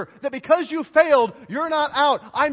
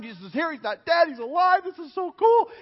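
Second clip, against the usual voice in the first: excited; 6 semitones higher than usual. Acted. Preacher imagining joyful barbecue.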